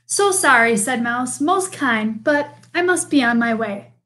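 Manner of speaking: put-on lively read-aloud for children; storybook reading. A narrator's reading. Teacher reading a picture book to the class, lively storytelling.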